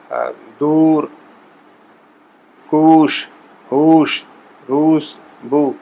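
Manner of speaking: دور کوش ہوش روس بو with the واو as a long u like food; slow, rounded vowel demo; Persian long oo. Shown as a demonstration. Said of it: "فوڈ کا جو ہم بولتے ہیں ڈبل او".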